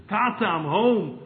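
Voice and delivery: joyful homecoming. Retold or imitated. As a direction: announce it to your father, delighted. Son arrives home joyfully.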